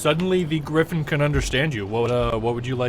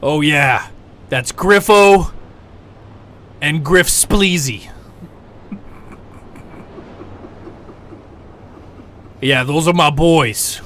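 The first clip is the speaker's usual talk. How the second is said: griffin voice; 3 semitones higher, 8 dB louder, slower than usual. Acted. Griffin names his friends.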